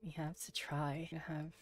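whispered plan; hushed strategy whisper. Real, not acted. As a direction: breathe it under your voice. Whispered plan mid-game.

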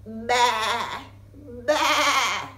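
loud bleating baa, rising; sheep bleat. Pretend. Reading a farm book aloud, bleating like a sheep. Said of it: "I bleat".

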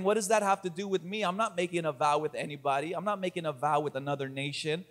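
low, quick, puzzled self-defence; wondering objection. Acted. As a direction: voice the doubter in a fast undertone. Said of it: "You might be wondering".

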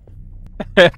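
high, loud burst of laughter. Real, not acted. Player cracks up at the Russian pointer gag, loud laugh.

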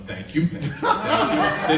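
imitated child soaking up applause, laughing; unshy daughter. Daughter thanking applauding crowd, bold and laughing. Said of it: "has no shy bone in her body".

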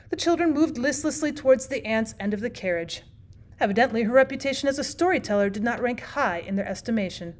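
plain reading voice

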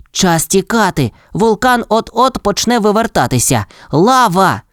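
high, alarmed call to flee; urgent exclamation. A narrator's reading. Rex warns the dinosaurs of lava, urgent exclamation. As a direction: raise the pitch and sound the alarm. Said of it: "а тоді вигукнув".

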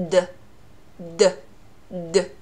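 D as a short voiced burst; isolated D sounds with the vocal cords buzzing; voiced consonant. Shown as a demonstration. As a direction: say D briefly with voice, spaced apart. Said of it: "就是浊辅音".

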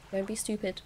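usual speaking voice

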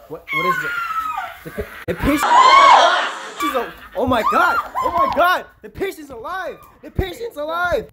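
high shrieking disbelief; shocked shouting. Acted. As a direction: yell in stunned disbelief. Shock at patient reviving.

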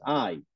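I said as the English diphthong "ai"; English I sound. Shown as a demonstration. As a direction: model I the English way, as "ai". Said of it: "como se pronuncia en inglés". (I pronunciada como en inglés, "ai")

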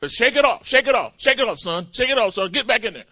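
loud, high coach's yell to get up; shouting coach. Retold or imitated. Football coach yelling at a hurt receiver, loud. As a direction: bark it like a football coach on the sideline. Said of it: "the coach would say".

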